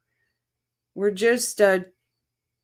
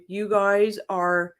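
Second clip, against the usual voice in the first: normal talking voice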